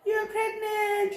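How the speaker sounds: high, teary laugh-cry of joy; aunt overjoyed, laughing and crying at the pregnancy news; laughing through tears. Retold or imitated. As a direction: gush congratulations while half laughing, half crying. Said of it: "My aunt was like".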